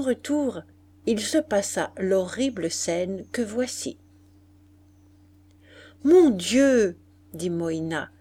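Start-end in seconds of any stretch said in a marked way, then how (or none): 6.0-7.0 higher, louder cry of complaint; exasperated exclamation. A narrator's reading. Moïna complains to her mother about their night, exasperated. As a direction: complain with a falling sigh of a tune.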